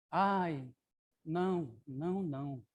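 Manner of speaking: "não, não" cada vez mais baixo; peça sem coragem, quase recuando; pedido receoso. Acted. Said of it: "pede Anísia, balançando a cabeça". (pedido baixinho e receoso)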